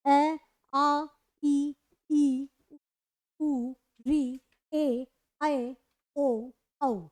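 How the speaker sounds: each vowel said slowly and clearly, one by one; reciting the vowels. Shown as a demonstration. Teacher recites the eleven vowels to students, slow and careful. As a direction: read out the vowel row carefully for the class. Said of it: "ये आपके ग्यारह स्वर हो गए".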